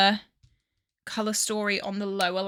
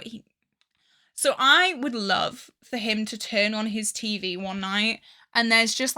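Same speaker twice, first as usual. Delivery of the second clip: ordinary talk, nothing marked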